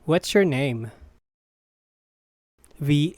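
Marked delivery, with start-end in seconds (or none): none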